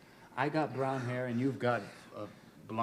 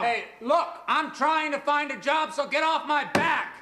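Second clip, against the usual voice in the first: higher and louder, shouting back in anger; angry shout; 10 semitones higher, 4 dB louder, slower than usual. Acted. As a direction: blow up at the father who keeps insulting you. Son shouting back at father.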